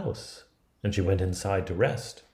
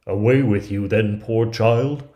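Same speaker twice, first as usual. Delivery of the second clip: put-on louder, sending the child off with pity; a huntsman letting his victim run; 8 dB louder than usual. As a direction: shoo her away loudly but kindly. Huntsman spares the girl.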